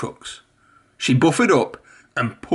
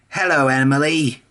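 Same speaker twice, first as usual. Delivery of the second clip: cheerful whistling Whiff. A narrator's reading. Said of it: "whistled Whiff cheerfully".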